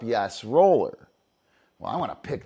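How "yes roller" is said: loud, slow call of the cue; marker call. Shown as a demonstration. Trainer marking the pickup for the dog, a slow loud call. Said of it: "as soon as it's picked up".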